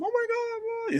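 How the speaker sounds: high-pitched imitation of a woman wailing; hammed-up high cry of alarm; mock panicked wail. Imitates a frightened woman in house.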